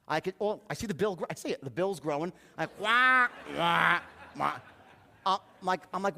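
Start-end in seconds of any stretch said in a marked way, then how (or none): 2.8-4.6 loud, drawn-out quacking; big imitation duck squawks; mock duck quack. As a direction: quack mockingly like a stubborn duck.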